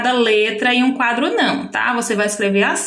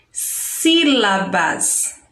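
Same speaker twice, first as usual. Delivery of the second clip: "Sílabas" very slowly, as sí-la-bas; "Sílabas" in three slow, joined beats; stretched syllables; 2 semitones lower, much slower than usual. Shown as a demonstration. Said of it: "ela sai em três sons".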